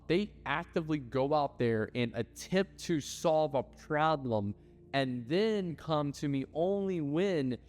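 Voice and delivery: ordinary talk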